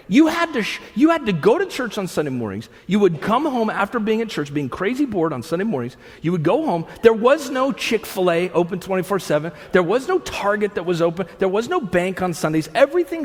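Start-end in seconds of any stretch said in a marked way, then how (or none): none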